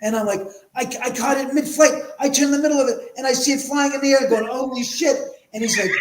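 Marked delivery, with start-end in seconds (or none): 0.8-5.2 retold with high, eager excitement; bubbling over about the catch; pitched up and thrilled; excited. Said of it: "I'm excited".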